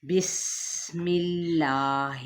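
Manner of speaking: very slow, every letter drawn out; slow tajweed recitation. Shown as a demonstration. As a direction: recite it slowly, as a model to copy. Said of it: "مثلاً".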